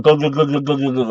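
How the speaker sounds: rapid string of mock gulps; imitated glugging. Storyteller mimics Jimmy draining the whole bottle, imitated glugs. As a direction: glug like a bottle being emptied.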